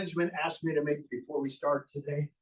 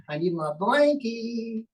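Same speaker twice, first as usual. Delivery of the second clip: put-on baby puppet voice; 5 semitones higher, slower than usual. Baby puppet asks for blanket.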